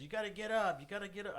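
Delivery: urgent wake-up call. Retold or imitated. Man retelling an emergency wake-up, urgent.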